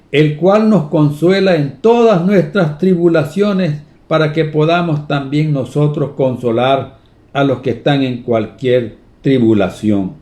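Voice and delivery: scripture reading. Acted. Preacher reading Second Corinthians aloud, solemn. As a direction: proclaim the verse to the church.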